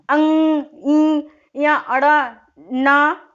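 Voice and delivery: अंग and ना pushed through the nose, loud and very slow; nasal sounds demo. Shown as a demonstration. Teacher sounding nasal letters through the nose for students. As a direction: hum the nasals out slowly and loudly. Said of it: "ये सब नाक से होता है".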